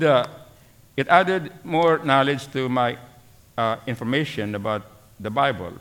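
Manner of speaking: normal talking voice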